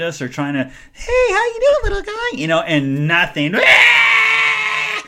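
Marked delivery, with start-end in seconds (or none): 0.9-2.4 put-on very high baby-talk voice; imitated sing-song stranger; cooing at a child. Strangers soothing a crying child. 3.6-5.0 long, loud, high mock scream; piercing fake bawl; imitated tantrum wail. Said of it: "he is just having a fit".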